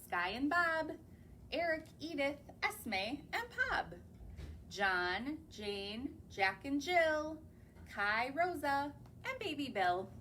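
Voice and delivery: lively, sing-song list of names; reading to children. Acted. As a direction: read each name out for little ones.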